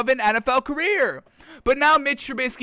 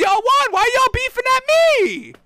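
mock indignant high voice; 14 semitones higher, 3 dB louder than usual. Mock outrage at the audience.

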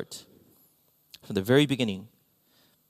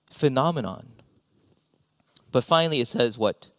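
ordinary talk

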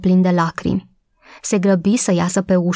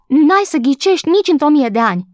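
higher and louder, calling out eagerly; breathless excited call; 8 semitones higher, 3 dB louder than usual. A narrator's reading. Maid runs to the old gardener with news, excited and breathless. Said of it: "strigă ea, gâfâind".

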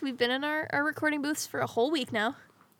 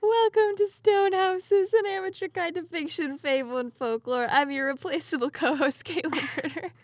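announcer intro; 5 semitones higher than usual. Acted. Podcast intro, announcer style.

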